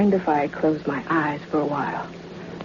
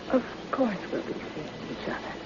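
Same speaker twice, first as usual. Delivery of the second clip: much softer, breathy whisper of a promise; 2 semitones higher, 10 dB quieter than usual. Acted. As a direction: whisper it close, almost secret. Myra's parting line to Barclay.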